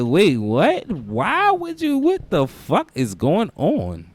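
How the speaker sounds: slow, rising what-the-heck; drawn-out confused reaction; baffled disbelief. Retold or imitated. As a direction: sound totally thrown by it. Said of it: "And I'm like".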